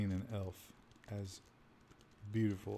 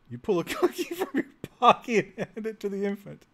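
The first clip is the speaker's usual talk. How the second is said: reading through a real laugh; breaking into laughter mid-sentence; 8 semitones higher, 5 dB louder than usual. Real, not acted. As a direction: giggle while reading the line aloud. Laughs reading absurd game text.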